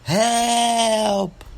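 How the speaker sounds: high, drawn-out scream; screaming for help. A narrator's reading. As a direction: scream it long and piercing, like a terrified old woman. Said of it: "she jumps up and screams".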